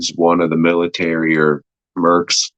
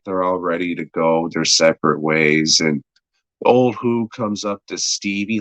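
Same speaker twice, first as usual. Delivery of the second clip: normal talking voice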